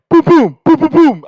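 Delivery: rapid volley of loud, high booms; imitated gunfire. Both brothers unload at the deer, explosive. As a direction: fire off explosive mock gunshots.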